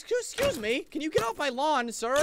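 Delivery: put-on high, loud cranky old man; hammed-up old man shooing someone away; grumpy old man. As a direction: shoo the kid off your lawn. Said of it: "Like an old man over here".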